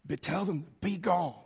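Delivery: hushed, urgent stage prompt; backstage prompting. A narrator's reading. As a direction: whisper the forgotten line from the wings. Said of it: "the prompter from backstage was saying".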